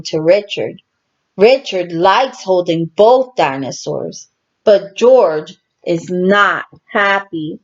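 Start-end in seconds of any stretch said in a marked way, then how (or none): none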